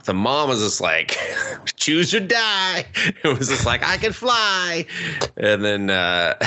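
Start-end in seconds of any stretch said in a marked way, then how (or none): 1.8-2.8 slow, high mock mom voice; a hint of a dramatic mom. Friend recapping a show, mocking the mom, slow and high. 3.8-4.8 slow, high, dreamy mock voice; lightly voiced dreamy girl; mock naive voice. Friend recapping a show, mocking the daughter, slow and high.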